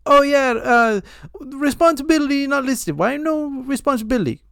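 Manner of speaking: high mock Indian caller in broken English; imitating the caller, high and accented. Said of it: "stereotypical telemarketer".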